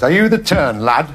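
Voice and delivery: mock club-secretary challenge; concert secretary. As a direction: bark a gruff greeting at the act. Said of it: "greeted by the concert secretary".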